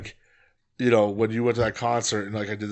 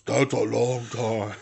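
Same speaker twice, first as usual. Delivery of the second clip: Andre the Giant voice; slower than usual. Pretend. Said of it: "Andre the Giant voice".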